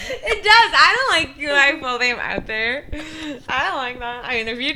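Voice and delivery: half laughing, half whining. Real, not acted. Laughing complaint.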